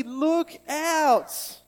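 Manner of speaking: loud, high call of look out; yelled warning. Shown as a demonstration. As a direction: shout it to stop someone at a cliff edge. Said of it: "can you say that with me?".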